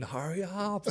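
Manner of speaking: high-pitched imitating hurry up; lightly hammed-up teammate; mock teammates yelling. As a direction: squeal it like the impatient middles. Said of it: "they were going off, going".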